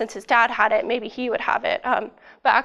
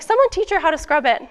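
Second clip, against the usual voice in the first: higher, louder order to the room; bossy surgeon; 6 semitones higher, 5 dB louder than usual. Retold or imitated. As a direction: call out the order like the boss.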